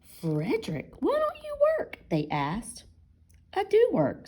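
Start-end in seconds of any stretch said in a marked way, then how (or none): 0.1-2.1 high mouse voice asking; questioning mice. Acted. 3.5-4.3 high, loud "I do work"; insistent mouse. Acted. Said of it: "said Frederick".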